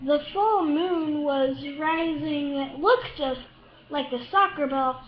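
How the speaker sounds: ordinary delivery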